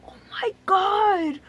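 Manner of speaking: high, drawn-out whine; mock horror. Retelling the sight of the needle, mock horror. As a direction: stretch it into a dismayed wail.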